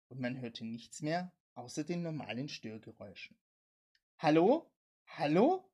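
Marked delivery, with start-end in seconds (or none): 4.2-5.7 loud, rising questioning call; two loud hellos, each rising sharply. A narrator's reading. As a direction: call into a dead radio line. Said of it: "rief er".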